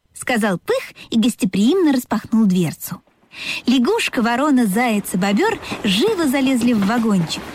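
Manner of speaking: ordinary delivery, nothing marked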